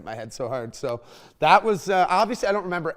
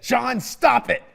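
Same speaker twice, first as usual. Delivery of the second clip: high, drawn-out motherly command; mock-stern mother; mom snapping an order; 8 semitones higher, 4 dB louder, slower than usual. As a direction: imitate mom putting a stop to it, slow and raised. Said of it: "my mom walked in the room and said".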